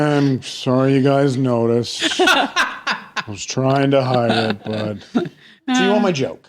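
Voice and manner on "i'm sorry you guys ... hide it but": slow, laughing fake apology; mock confession laughing.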